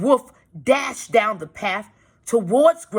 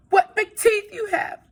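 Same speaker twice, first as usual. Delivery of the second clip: put-on scared child; 3 dB louder than usual. Frightened girl.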